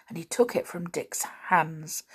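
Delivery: ordinary delivery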